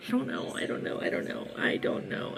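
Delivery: whispered, repeated helplessly under her breath; overwhelmed whisper. Real, not acted. Overwhelmed by the story, whispering to her viewers. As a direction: breathe it out, lost for words.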